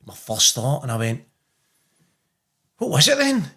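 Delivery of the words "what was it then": high, tense question; anxious question. Retold or imitated. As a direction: ask it high and worried. Anxious father asking the son.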